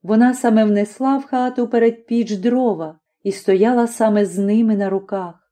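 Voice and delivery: usual speaking voice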